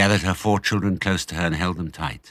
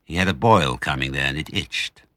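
plain reading voice

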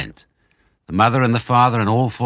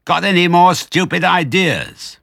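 very high, loud, slow shout; shouting back; 12 semitones higher, 7 dB louder than usual. A narrator's reading. Bunce shouting at deaf Bean. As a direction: yell it into a deaf ear. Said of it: "Bunce shouted back".

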